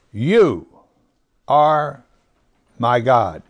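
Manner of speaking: spaced slowly word by word, weight on "You"; stress on You. Shown as a demonstration. Preacher reading the psalm to the congregation, stress on "You". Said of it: "where is the emphasis on you".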